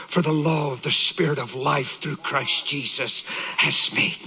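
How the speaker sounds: low, hushed reverent sermon voice; grave, low-pitched pulpit delivery; solemn preaching. Real, not acted. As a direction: let each phrase sink in, with long pauses.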